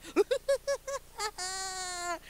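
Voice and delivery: high, long horror-movie cackle; imitation of a toddler's crazed laugh; mock maniacal laughter. Mom imitating toddler switching from tears, maniacal cackle. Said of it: "switches from this ridiculous crying to this maniacal".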